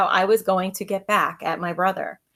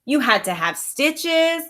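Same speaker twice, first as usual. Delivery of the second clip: put-on higher, slow, eager kid telling the news; excited; 6 semitones higher, 3 dB louder, slower than usual. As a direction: boast about watching it all. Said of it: "he said excitedly".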